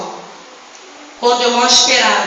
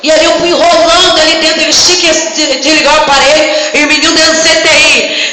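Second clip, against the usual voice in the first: rising, rhythmic proclamation of miracles; full-force preaching; 3 semitones higher than usual. Real, not acted. Preacher declaring healings to the church, full-force preaching. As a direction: drive it home phrase after phrase.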